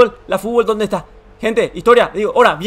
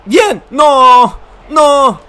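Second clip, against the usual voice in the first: shout; 5 semitones higher, 9 dB louder, much slower than usual. Real, not acted. Reacting to missed chance.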